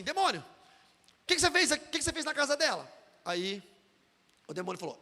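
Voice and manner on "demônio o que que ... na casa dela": loud, higher mock demand; pastor interrogating demon. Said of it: "ele falou assim". (pastor interrogando demônio, em voz alta)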